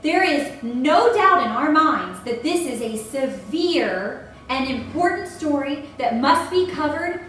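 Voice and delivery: usual speaking voice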